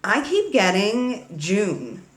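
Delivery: usual speaking voice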